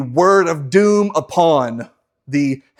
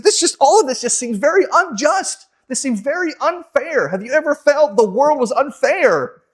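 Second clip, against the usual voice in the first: indignant complaint; 9 semitones higher than usual. Acted. Habakkuk protests injustice.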